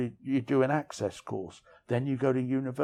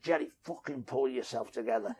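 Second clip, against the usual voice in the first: a retold hint of a grating Liverpool accent; imitating a Liverpool accent, understated; 5 dB quieter than usual. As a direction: lightly put on Scouse for a cellmate's pep talk. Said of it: "he had this really grating Liverpool accent".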